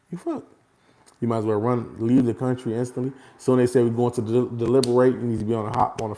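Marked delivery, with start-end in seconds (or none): 0.1-0.5 a sudden high squeak; mock squeak. Real, not acted. Warning his audience about juries, a high mocking squeak.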